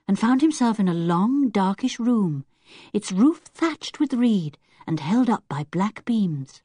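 plain reading voice

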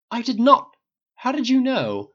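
high, startled protest; shocked denial. Acted. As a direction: gasp out the denial. Harry shocked, caught out.

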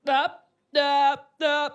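loud head-shaking refusals; dismissive teacher. Retold or imitated. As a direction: reject it like a doubting coach. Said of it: "always like".

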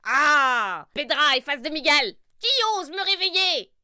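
voix de sorcière aiguë et forte; sorcière furieuse. Acted. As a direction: crie comme une sorcière tirée du sommeil. Sorcière réveillée en colère. (sorcière en colère)

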